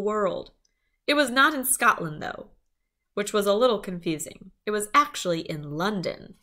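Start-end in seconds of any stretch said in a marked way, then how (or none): none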